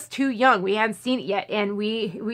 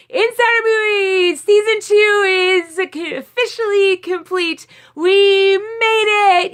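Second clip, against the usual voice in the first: very high, loud, squealing cheer; giddy high-pitched shouting, real; excited celebration; 9 semitones higher, 7 dB louder than usual. Real, not acted. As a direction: shriek for joy at finishing. Celebrating finishing the season.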